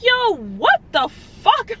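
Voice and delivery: high, drawn-out disbelief; shocked disbelief. Retold or imitated. Patient retelling the shock of a hard fall, high incredulous. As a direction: stretch it out, incredulous and high-pitched.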